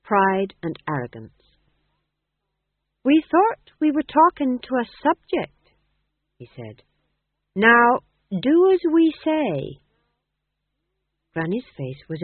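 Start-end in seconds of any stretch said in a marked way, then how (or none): 3.0-5.7 high, loud, arrogant monarch; proud, imperious royal blast; royal hauteur. A narrator's reading. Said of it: "a blast of royal hauteur, pride and arrogance". 7.5-9.8 loud, high, slow command; imperious king demanding obedience; royal hauteur. A narrator's reading. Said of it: "a blast of royal hauteur, pride and arrogance".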